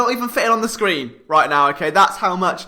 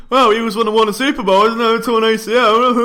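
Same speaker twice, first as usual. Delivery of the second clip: faster, raised voice of a scoffing listener; mock objector; 3 semitones higher, 4 dB louder than usual. Host voices listener objecting to his theory, quick mock objection. Acts out a doubting listener, then laughs.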